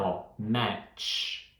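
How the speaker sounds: ordinary talk, nothing marked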